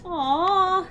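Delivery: loud, high sympathetic coo. Real, not acted. Hearing about a viewer's cats, loud coo. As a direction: let out a big high aw.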